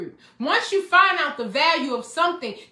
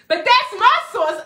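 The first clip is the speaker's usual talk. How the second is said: higher and louder, bursting with joy; excited; 7 semitones higher, 5 dB louder than usual. Real, not acted. Preacher to his congregation, overflowing with excitement. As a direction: let the excitement spill over. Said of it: "I'm so excited".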